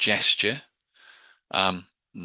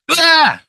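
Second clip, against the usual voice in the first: sharp loud startling yell; a shock shout to break the pattern; sudden shout; 14 dB louder than usual. Shown as a demonstration. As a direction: jolt them with a sudden cry. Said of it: "doesn't need to be loud or shocking".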